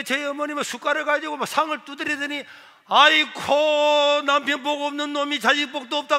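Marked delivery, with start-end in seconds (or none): 2.9-6.2 acted wail of self-pity; wailing mother. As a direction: imitate a mother bemoaning her lot, stretching the first cry. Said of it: "그러더니 하면서 막 우는 거예요".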